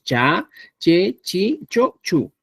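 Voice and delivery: "cha, che, chi, cho, chu" with a plain unaspirated ch; simple ch syllables. Shown as a demonstration. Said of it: "Los que son fáciles de pronunciar, decimos".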